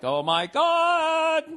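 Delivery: very high, slow, camp Oh my God; flamboyant impression. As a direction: ham up the camp sitcom character. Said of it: "the other guy was just like".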